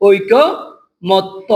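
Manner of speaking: ঐকমত্য slowly as "oikko ... motto"; careful slow ঐকমত্য with held o endings; syllable-by-syllable demo. Shown as a demonstration. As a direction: pause between oikko and motto in ঐকমত্য, doubling the t. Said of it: "ত আমরা কয়বার লিখবো?".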